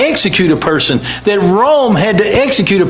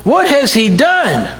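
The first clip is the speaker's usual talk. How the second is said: higher, slow, raised question; Pilate's shout; 5 semitones higher, slower than usual. Retold or imitated. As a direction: demand it of the crowd.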